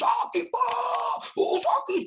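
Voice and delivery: high, drawn-out cry of pain; retold scream of pain. Preacher voicing the tormented wife crying out in pain. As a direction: wail it like the sick woman in agony. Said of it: "wah, dia teriak".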